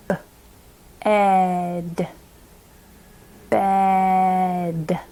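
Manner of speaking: b, then ed stretched, then bed blended long; drawn-out, low bed in parts; sounding out. Shown as a demonstration. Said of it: "sounding that one out".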